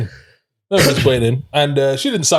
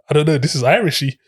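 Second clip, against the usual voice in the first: ordinary talk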